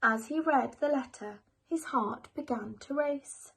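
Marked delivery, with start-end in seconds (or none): none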